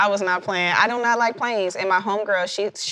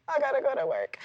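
higher, softer, weary groan of a line; mock dreading; 6 semitones higher, 6 dB quieter, faster than usual. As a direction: drag it out like you hate Mondays. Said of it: "be like".

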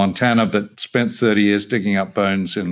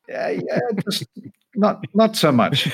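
laughing quote; 11 semitones higher than usual. Retold or imitated. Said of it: "He said".

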